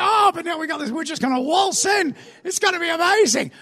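high-pitched giddy boasting; excited anticipation. Acted. Freed slaves about to waltz into the promised land, excited. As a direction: gush with hammed-up hope. Acting the people's high hopes.

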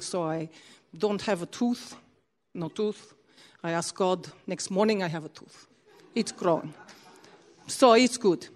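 low, slow imitated Russian accent. Russian man gives healing testimony to preacher, imitated Russian accent. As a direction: play a casual Russian man, softly accented. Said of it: "I'll try and do a Russian accent".

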